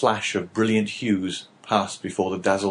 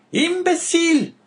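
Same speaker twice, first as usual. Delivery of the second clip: much higher, louder, drawn-out scornful cry; scornful exclamation; 5 dB louder than usual. Acted. Wife scorning her husband, a high drawn-out cry. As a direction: spit the insult at a foolish husband. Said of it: "exclaimed La Carconte".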